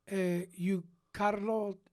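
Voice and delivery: put-on slow, puzzled Italian accent. Italian at rehearsal checks the stranger's name, Italian accent. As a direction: question him in halting Italian-accented English. Italian man questioning the American tenor.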